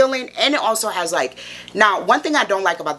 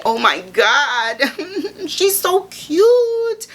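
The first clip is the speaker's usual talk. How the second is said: drawn-out, high-pitched adoring squeal; gushing squeal; 12 semitones higher, much slower than usual. Real, not acted. Vlogger fawning over her mini Kelly, high gushing squeal. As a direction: melt into a slow, giggly coo.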